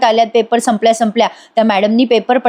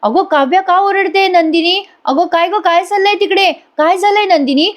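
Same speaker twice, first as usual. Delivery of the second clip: retold panic; 8 semitones higher than usual. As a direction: imitate a frightened mother calling down the phone, pitched up. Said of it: "आता मानिनी घाबरते आणि म्हणते".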